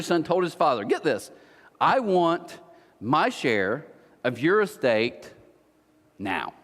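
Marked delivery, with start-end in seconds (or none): 1.8-6.5 measured, with long pauses, dropping low at the end; slow emphatic demand. Acted. Younger son demanding inheritance from his father, slow and emphatic. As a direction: space it out and sink onto "now".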